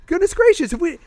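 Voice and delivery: high, mock-shocked squeal of goodness gracious; mock exasperated exclamation. Teasing the co-host who says she hates, mock-shocked high cry. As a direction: throw up your hands in fake dismay.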